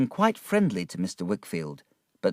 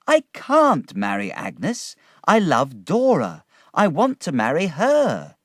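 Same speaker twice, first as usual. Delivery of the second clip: high earnest protest; 8 semitones higher, 5 dB louder than usual. A narrator's reading. David protests his love.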